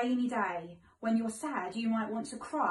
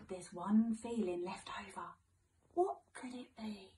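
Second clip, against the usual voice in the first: whispered, softer secret; mysterious whisper; 6 dB quieter than usual. Acted. Building mystery for children.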